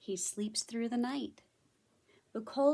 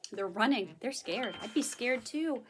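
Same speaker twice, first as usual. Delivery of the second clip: ordinary delivery, nothing marked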